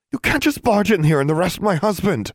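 high-pitched outraged wife; indignant protest. A narrator's reading. Wife protests husband's arrest.

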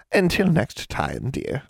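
put-on an old witch toying with a girl; sly old hag. A narrator's reading. Hag bargaining with a young witch, lightly sly.

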